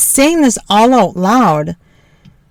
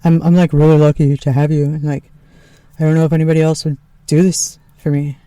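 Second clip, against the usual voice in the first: lightly lower, quick earnest husband; a hint of husband; 6 semitones lower, faster than usual. Wife correcting her quote of Tony, lightly lower voice. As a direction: redo his line a bit lower and faster. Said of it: "No, hang on".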